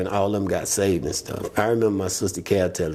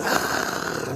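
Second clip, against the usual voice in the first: normal talking voice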